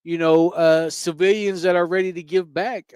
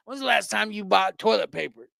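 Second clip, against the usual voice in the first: put-on old guy voice; 2 semitones higher than usual. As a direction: mimic a funny old man. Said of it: "the way he says it's way funny".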